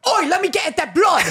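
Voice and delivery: high, rowdy Cockney shout; hammed-up Cockney vampire yob; mock Cockney. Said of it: "you're doing Cockney".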